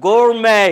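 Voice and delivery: গোরমে said as gor-MAY, with the r and no t; American pronunciation. Shown as a demonstration. Teacher confirming a student's answer, clear and emphatic. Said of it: "আপনি সঠিক নামটাই জেনেছেন".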